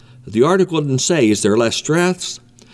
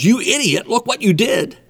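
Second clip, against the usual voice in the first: higher-pitched imitation of a furious driver; mock angry yell; 8 semitones higher than usual. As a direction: play the other driver snapping at you in a parking lot. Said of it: "jumps out and yells".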